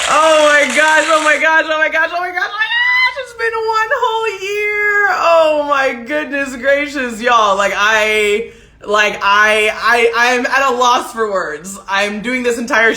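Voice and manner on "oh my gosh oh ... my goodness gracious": high, squealing rush of oh-my-goshes; giddy high-pitched celebration; overexcited. Real, not acted. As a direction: gush in disbelief, voice shooting up. Celebrating show's first anniversary.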